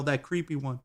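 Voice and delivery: ordinary talk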